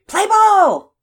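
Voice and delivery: very high, loud drawn call; umpire's yell. A narrator's reading. Umpire starts the girl's game, loud high yell. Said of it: "as the umpire yelled".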